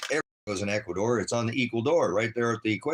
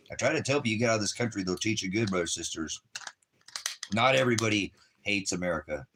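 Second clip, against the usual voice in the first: normal talking voice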